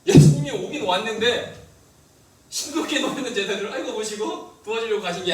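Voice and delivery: emphatic preaching. Real, not acted. Preacher stressing a point.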